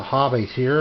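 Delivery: ordinary talk, nothing marked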